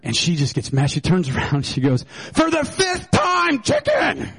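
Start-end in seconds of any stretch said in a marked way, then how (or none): 2.3-4.3 much higher and slow, then a sudden shouted chicken; fed-up wife hollering the answer; exasperated shout. Retold or imitated. Wife yelling back at her husband about dinner, a high, slow, exasperated shout.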